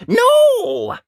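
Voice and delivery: Ned defying his mum for the first time; cross no. Acted. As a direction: fling it out, hot and cross. Ned refusing fisherman.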